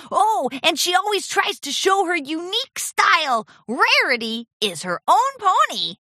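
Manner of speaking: high, loud gushing little dragon; adoring Spike. A narrator's reading. Dragon praising his idol to her apprentice, gushing. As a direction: gush with big, high-pitched admiration.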